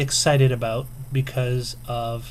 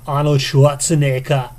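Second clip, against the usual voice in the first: louder, mock Austrian accent; Arnold Schwarzenegger accent; 7 dB louder than usual. Vlogger listing movie-based games, mock Austrian accent. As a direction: boom the name in a put-on Austrian accent. Puts on the actor's accent in passing.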